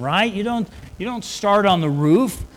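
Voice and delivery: ordinary talk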